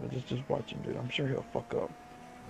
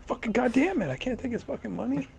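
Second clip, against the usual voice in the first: frustrated; 9 semitones higher than usual. Real, not acted. Loses the money grab.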